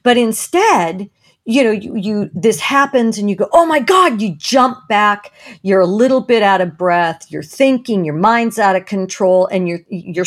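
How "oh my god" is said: high, loud mock gasp of fright; startled cry. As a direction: jump back with a sudden high cry. Jumping back from snake.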